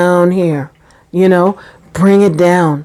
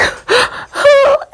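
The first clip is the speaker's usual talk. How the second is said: high, sobbing intake of breath; mock bawling gasp. Weepy pregnant woman reacting to a mango, mock bawling gasp. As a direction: gasp as if about to burst into tears. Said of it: "you just begin to bawl".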